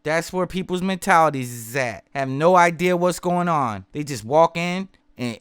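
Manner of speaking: ordinary talk, nothing marked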